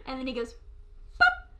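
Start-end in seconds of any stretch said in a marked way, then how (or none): 1.2-1.5 sudden high loud pop. Pretend. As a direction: burst out like a toy popping. Jack-in-the-box pops.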